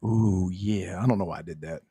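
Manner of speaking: low gruff macho growl; macho man voice. Pretend. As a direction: growl it like a pro wrestler. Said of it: "I sound like a homosexual macho man".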